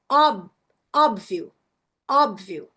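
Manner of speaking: 'óbvio' with a loud first syllable closed on B; audible mute B. Shown as a demonstration. Teacher proving mute consonants sound, B voiced in 'óbvio'. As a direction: punch 'ób', no vowel after the B. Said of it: "O D e o B tão aí".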